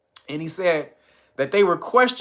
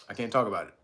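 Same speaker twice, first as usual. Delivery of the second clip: lower, soft guarded dad voice; imitated cagey cop dad; stern dad; 8 semitones lower, 10 dB quieter, much faster than usual. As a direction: shut the topic down quietly. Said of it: "his dad says".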